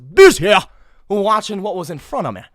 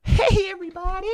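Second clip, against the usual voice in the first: high, sing-out hello to the camera; mock influencer greeting; 11 semitones higher than usual. Girl greets viewers bending to the camera, high mock-perky hello. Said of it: "and then be like".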